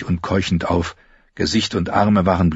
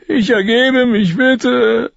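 much higher, pleading with ICH leaned on; a frightened boy begging, high and a bit slow; captured boy begging the soldiers, much higher; 4 dB louder, much slower than usual. Acted. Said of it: "Lommi surrendering again".

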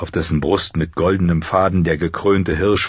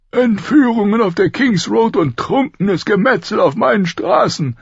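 high, forceful outrage; raised royal indignation; angry king; 4 dB louder than usual. A narrator's reading. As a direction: rail at the lawlessness. King rages about lawlessness.

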